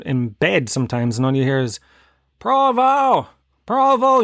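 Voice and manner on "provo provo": high, loud yell across the street; calling a dog. Retold or imitated. Neighbour yelling for the dog, high calling voice. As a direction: call the dog from the back door.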